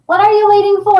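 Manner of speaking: high, loud urging question. Retold or imitated. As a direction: push them to get going. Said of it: "they're like".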